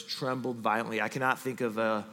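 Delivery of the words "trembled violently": quiet repeated phrase. Shown as a demonstration. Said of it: "those two words".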